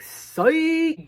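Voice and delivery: high, loud mock gotcha; spring the surprise with a loud high shout; psych. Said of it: "He's like".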